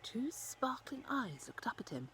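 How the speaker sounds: hushed whisper as the eyes appear. A narrator's reading. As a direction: lean in and breathe it. Narrator whispers the surprise.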